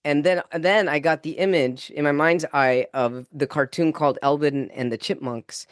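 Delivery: normal talking voice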